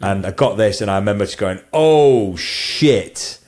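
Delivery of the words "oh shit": loud, high drawn-out "oh" of delight; amazed exclamation. Retold or imitated. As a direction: relive the thrill of nailing it. Recalls delighted reaction to shot.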